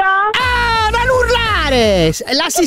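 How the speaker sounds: long, drawn-out high scream; exasperated yell. Acted. Annoyed caller telling the operator off, exasperated yell. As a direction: yell it high, stretching the vowels. Irritated caller yells back at her.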